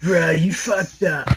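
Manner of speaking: slow, laid-back surfer drawl; surfer dude voice. Retold or imitated. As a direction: stretch it out like a Malibu surfer. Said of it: "talking like a Malibu surfer".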